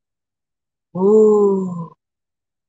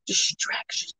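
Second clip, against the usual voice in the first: a quick voiceless whisper; 10 dB quieter than usual. Real, not acted. Seller on a livestream noting distractions, whispered. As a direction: whisper it under your breath. Muttering to self on live.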